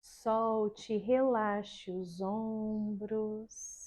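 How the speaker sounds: slow, soft, soothing relaxation voice; guided meditation. Shown as a demonstration. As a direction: lull the class into calm, very slowly. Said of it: "E aí você vai começar assim, ó".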